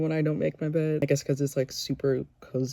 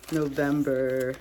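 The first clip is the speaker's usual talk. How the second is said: ordinary delivery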